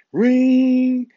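a high sung ring sound like a phone going off; mock phone ring. Mimicking his phone interrupting the recording, high mock ring.

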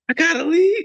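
high, loud astonished question; imitated girlfriend. Girlfriend being told to get out, imitated surprise.

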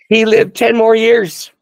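loud, high, drawn-out proclamation; triumphant exclamation. Real, not acted. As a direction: belt out the good news, slowly and jubilantly. Triumphant climax of healing story.

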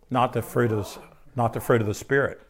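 ordinary delivery

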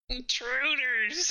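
high, drawn-out goblin call; goblin alarm cry. Acted. Goblin spots the party round the corner, alarm cry. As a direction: stretch it out as a high-pitched alarm. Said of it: "And it goes".

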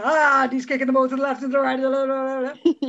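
hammed-up sports commentary through laughs; football commentator. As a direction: play it like a match commentator. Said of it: "football presenter".

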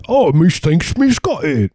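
high, slurred cartoon Cockney; exaggerated Cockney, pitched high; mock Cockney pupil. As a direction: squeal it as a proud Cockney learner. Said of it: "and then they're like".

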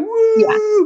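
long, high, loud mock cheer; hammed-up crowd "woo"; overjoyed whoop.

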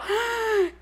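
high, drawn-in gasp; shocked gasp. Retold or imitated. Friend hears the scream on the phone, shocked gasp. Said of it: "y yo".

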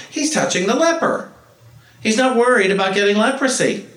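high, loud astonishment; amazed onlookers. Retold or imitated. Onlookers seeing Jesus touch the leper, in high loud amazement. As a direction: marvel out loud.

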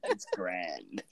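low, soft mock British "it's grand"; mock British accent. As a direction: say it like a Brit across the pond. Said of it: "as they would say across the pond".

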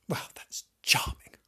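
dry whispered aside; sarcastic whisper. Real, not acted. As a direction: mutter it under your breath, unimpressed. Sarcastic aside after no gifts.

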